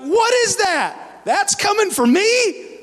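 high, incredulous, comic alarm; alarmed disbelief. Retold or imitated. Young believer seeing the beast on the chart, alarmed. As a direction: play up the horrified disbelief.

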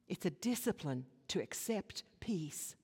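hushed, breathy whisper; whispered repeat of the line. Shown as a demonstration. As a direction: lean in and whisper it.

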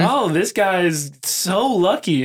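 high, drawn-out envious gush; mock admiration. Speaker mimicking people who credit luck over work, mock admiration. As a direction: imitate an impressed onlooker, stretching it out.

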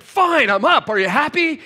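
high, loud angry snap; angry retort. Retold or imitated. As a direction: fire it back at him, furious. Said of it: "stormed out of the room".

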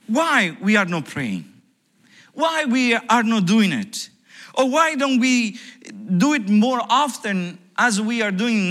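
loud, high preaching voice; preaching at full force. Acted. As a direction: drive it home to the congregation.

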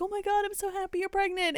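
high-pitched imitation of delight; mock gushing. Retelling Eliza's happy pregnancy news, mock gushing.

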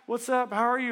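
high, bright mock-cheerful hellos; hammed-up cheery welcome from the church staff; chipper greetings.

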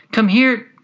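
high, loud governess's summons; calling out. A narrator's reading. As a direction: call sharply to a pupil out of sight. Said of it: "she would call out".

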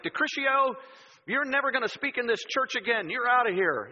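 high, loud mock banishment; imagined angry pastor. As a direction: throw someone out in hammed-up outrage. Imagined pastor banishing him angrily.

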